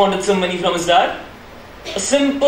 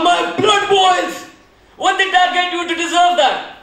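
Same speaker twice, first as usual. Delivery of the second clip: angry outburst; 7 semitones higher than usual. Acted. Hacker rages at news.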